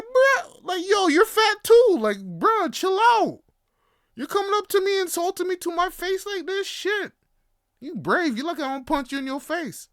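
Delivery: high, loud indignant clapback; mock outraged comeback. Firing back at the little kid who called him fat, loud and high. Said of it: "I'm like".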